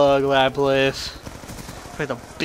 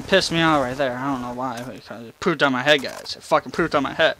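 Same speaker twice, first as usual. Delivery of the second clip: usual speaking voice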